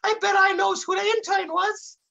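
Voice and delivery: very high, eager sidekick voice; Dummerton. A narrator's reading. Interrupting Brick with a guess, high eager voice. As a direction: butt in with a high goofy voice. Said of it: "called Dummerton, interrupting Brick's story".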